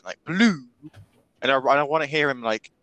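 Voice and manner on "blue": BLUE pitched up, mock-French; French-style pronunciation. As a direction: imitate a French-sounding BLUE. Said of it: "like a French person".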